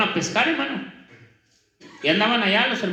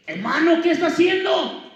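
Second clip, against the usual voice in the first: surprised shout; 9 semitones higher, 3 dB louder than usual. Retold or imitated. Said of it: "los hermanos le dijeron".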